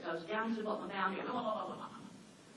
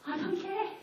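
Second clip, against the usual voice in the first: higher, laughing brush-off; laughing retort; 8 semitones higher than usual. Retold or imitated. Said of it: "I said".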